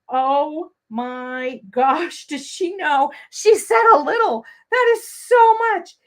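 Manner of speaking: hammed-up panic, building high and loud; mock panic. Teacher voicing her panicking viewers, mock panic. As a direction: play an alarmed student, drawn out then escalating. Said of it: "you guys may be panicking".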